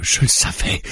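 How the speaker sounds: breathy, held-back first "Je le savais"; muttered start. A narrator's reading. Mara realises he is one of them, muttering. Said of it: "dit-elle en haussant la voix".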